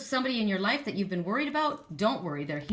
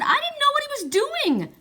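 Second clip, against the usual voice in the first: high, loud mock protest; retold protest; 8 dB louder than usual. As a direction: protest your innocence, half laughing. Retells protesting to Julie.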